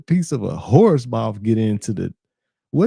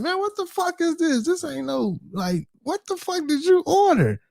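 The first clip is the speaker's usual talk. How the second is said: much higher pitched shocked disbelief; imagined disgusted outburst. Retold or imitated. Streamer imagining his own reaction to the teeth, high shocked disgust. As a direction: play it as if you just found teeth in your food. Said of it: "I would've instantly been like".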